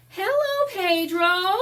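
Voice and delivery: very high, loud sing-song hello; high chorus greeting. Acted. The neighborhood children greeting Pedro, very high and loud. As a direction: greet him like a crowd of happy kids.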